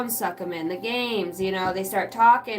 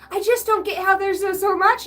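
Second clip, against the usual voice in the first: high, louder mimicking voice; whining kids; 9 semitones higher, 5 dB louder than usual. Parent mimicking kids about the laundry, whining. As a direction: play a clueless kid, pitched way up.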